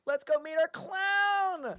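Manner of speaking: slow, showy ringmaster announcement; announcer flourish. Acted. Ringmaster introducing the clown to the guests. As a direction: drag it out for effect.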